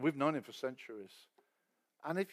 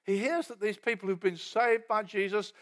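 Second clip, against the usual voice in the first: ordinary talk, nothing marked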